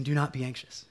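gentle, low-key reassurance; not angry. Acted. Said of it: "but he's not angry".

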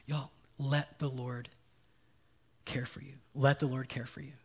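soft, low, gentle plea; tender reassurance. Acted. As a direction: hush right down and comfort them. Voicing Jesus's tender reassurance.

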